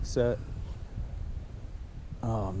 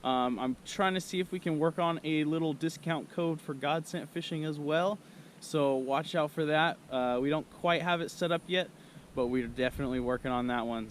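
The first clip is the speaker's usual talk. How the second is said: higher, upbeat ad read; sponsor outro; 6 semitones higher than usual. Acted. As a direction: wrap up the vlog cheerfully.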